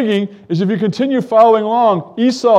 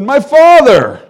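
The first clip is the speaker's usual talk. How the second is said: calling out; 8 semitones higher, 7 dB louder, slower than usual. Acted. Jacob calls to blind father.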